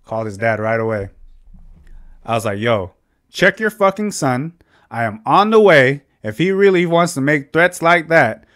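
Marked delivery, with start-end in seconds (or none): 2.6-8.5 heated, higher-pitched demand; retold angry challenge. Calling the brother's dad after being threatened, heated retold challenge. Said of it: "I fucking clicked".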